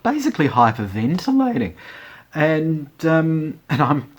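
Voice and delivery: ordinary talk, nothing marked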